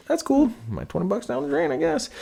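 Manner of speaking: high-pitched mock whine about being shortchanged; mock sulk. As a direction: whine it like a sulking kid. Complaining about small beer pour.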